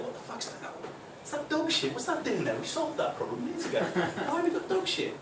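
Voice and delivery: high-pitched mock bafflement; surprised. Newcomer from Reading puzzling over dog mess, exaggerated surprise. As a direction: question it in squeaky, comic disbelief. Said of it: "I'm surprised".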